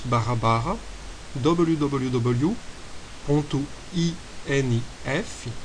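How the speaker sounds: ordinary delivery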